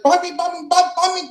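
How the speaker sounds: high, loud sing-song street cry; shrill vendor calling banh mi. Retold or imitated. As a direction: hawk sandwiches like a vendor yelling. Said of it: "she was screaming".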